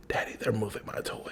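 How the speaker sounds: put-on whispered small boy; whispered toddler. Dad whispers son's worried complaint about toys. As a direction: breathe it like a worried little boy. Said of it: "He was like".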